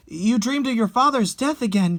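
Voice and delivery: ordinary delivery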